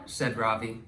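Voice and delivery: plain reading voice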